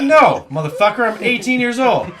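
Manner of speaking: mock outburst. Retorting to the professor.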